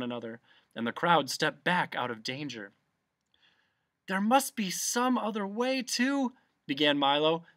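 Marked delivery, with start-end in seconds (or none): 4.1-6.4 high-pitched, hopeful interjection; anxious plea. A narrator's reading. Milo trying to stop the fight, high plea. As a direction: plead for peace, left unfinished.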